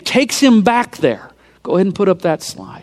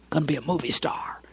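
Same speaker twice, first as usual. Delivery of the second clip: hushed, savoring whisper; whispered gloat; 3 semitones lower, 13 dB quieter, faster than usual. Retold or imitated. Preacher quoting the killer's delight, whispered. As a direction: breathe it like a private thrill. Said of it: "he's digging this".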